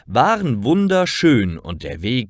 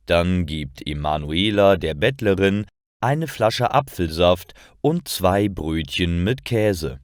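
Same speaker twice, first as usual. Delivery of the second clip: ordinary delivery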